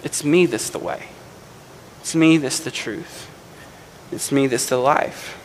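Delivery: Jesus lovingly answering a doubting Thomas. Retold or imitated. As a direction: gently reassure a frightened friend. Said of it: "in his loving way".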